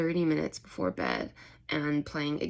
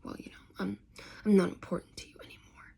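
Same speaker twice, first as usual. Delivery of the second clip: whispered, hurried muttering under the breath; muttering to herself; 3 semitones higher, faster than usual. Retold or imitated. Plang muttering to herself in a hurt whisper. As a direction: mutter it so she barely hears. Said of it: "muttering to herself".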